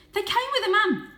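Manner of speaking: very high, loud insistence; urgent shout. A narrator's reading. Varjak insists to his parents, shouting high. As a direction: keep shouting the alarm. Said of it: "shouted Varjak".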